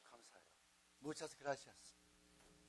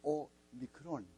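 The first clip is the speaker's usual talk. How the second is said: 오미크론 said slowly, each syllable clear; slow spelled-out name; 4 dB louder, much slower than usual. Shown as a demonstration. Teacher giving the class the letter name, slowly. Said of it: "헬라 말에는 오메가가 있고".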